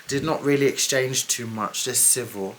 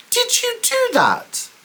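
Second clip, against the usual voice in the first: much higher, slowed, drawn-out demand; pointed question. Retold or imitated. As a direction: press him for the truth, slowly. Confronting him on the phone.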